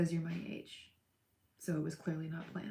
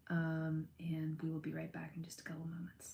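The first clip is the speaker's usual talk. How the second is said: unvoiced, hushed sign-off; whisper; 6 dB quieter than usual. Real, not acted. Whispering to viewers before muting. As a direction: whisper it as you step away. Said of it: "I'm just gonna mute".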